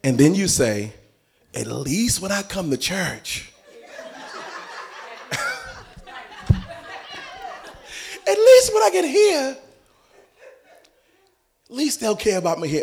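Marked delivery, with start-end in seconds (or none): 1.5-12.8 hammed-up tearful whine; playfully sobbing out a churchgoer's hurt; mock crying complaint.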